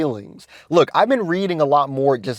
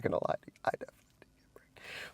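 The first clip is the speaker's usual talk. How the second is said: whispered confession; 18 dB quieter, faster than usual. Real, not acted. Admitting to viewers he got friend-zoned, whispered. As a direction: whisper it like a guilty secret.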